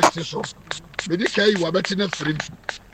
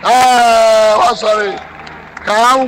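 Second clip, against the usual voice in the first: very loud, drawn-out cry, then higher; triumphant exclamation; 3 semitones higher, 8 dB louder, much slower than usual. Real, not acted. Prophet hailing the patient who has stood up, triumphant exclamation. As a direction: exclaim loudly at the feat.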